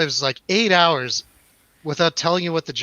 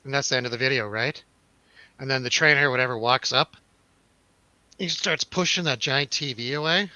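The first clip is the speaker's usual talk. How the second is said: usual speaking voice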